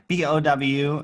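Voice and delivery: ordinary talk, nothing marked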